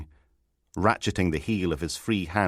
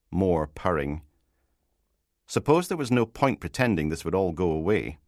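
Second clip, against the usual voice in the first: plain reading voice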